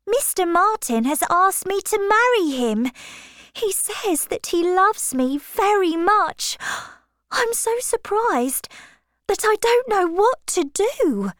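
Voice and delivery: put-on high, girlish and breathless with news; a young girl bursting with a proposal; flustered young Harriet. Harriet telling Miss Woodhouse about the proposal, high flustered girl.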